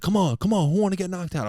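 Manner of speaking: mock troublemaker taunt. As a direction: goad the whole street into a fight. Imitating a street troublemaker.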